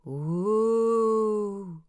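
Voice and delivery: long high wavering ghost moan; ghostly ooh. Acted. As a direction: moan like a ghost to scare him. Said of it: "making the first ghostly noise".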